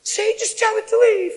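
anxious woman. Retold or imitated. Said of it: "She goes".

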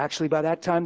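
ordinary talk, nothing marked

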